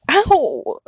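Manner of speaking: loud, high yelp of pain. Acted. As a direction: cry out from a slap. Just got slapped.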